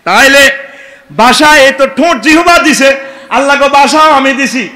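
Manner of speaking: loud, high fiery sermon; preaching at full force. Acted. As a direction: drive it at the crowd. Preaching to crowd.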